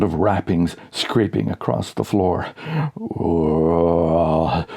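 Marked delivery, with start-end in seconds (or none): 2.9-4.7 low drawn-out moan; mummy moan. A narrator's reading. Mummy on the radio moaning, low and slow. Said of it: "says the mummy".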